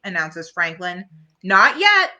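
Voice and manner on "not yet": louder, higher stop; firm "Not yet". Acted. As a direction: halt everyone. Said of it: "says Peppermint Patty".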